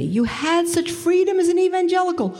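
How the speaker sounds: hammed-up friend protesting the church; mock indignant friend. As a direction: mimic her scorn, pitched well up. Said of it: "she said to me".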